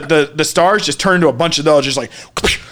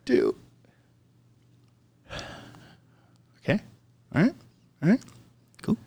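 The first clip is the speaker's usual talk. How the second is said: hushed counting; 12 dB quieter, faster than usual. Retold or imitated. Counting hits alone, much softer. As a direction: count the hits under your breath.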